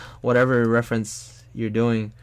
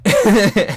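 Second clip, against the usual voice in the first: burst of laughter; 9 semitones higher, 10 dB louder than usual. Real, not acted. Laughs after his shout.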